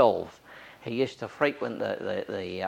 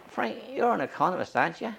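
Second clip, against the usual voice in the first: much higher, sly challenge; mock pointed question; 10 semitones higher than usual. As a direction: set him up, high and pointed. Priest retorts to critic, retold.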